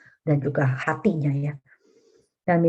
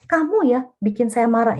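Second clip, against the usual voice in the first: higher, blaming stress on kamu; accusing spouse; 7 semitones higher than usual. Shown as a demonstration. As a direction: throw the blame with the word kamu at your partner. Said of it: "Kita perlu katakan". (menyalahkan pasangan, tekanan pada kamu)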